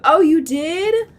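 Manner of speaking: loud, slow delighted surprise; surprised. Real, not acted. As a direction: react like it's big news. Viewer reveals she made Amelia.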